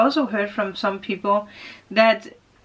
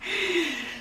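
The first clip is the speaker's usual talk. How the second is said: a short soft high laugh; embarrassed little laugh; 8 semitones higher, 8 dB quieter than usual. Real, not acted. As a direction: giggle at your own singing. Laughs after singing.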